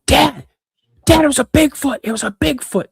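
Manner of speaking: put-on high, loud, breathless kid voice shouting for dad; retelling a frantic shout to wake dad; excited kid yelling. Kid waking dad after sighting.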